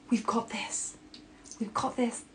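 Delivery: soft whispered "We've got this.". Shown as a demonstration. A loving voice soothing the listener, in a whisper. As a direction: breathe it close and comforting. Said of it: "Being like".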